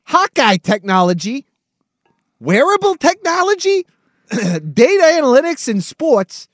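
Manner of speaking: high, slow wiseguy voice; Joe Pesci impression. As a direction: talk like a fast-talking wiseguy. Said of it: "my best, uh, Joe Pesci impression as Chat G P T".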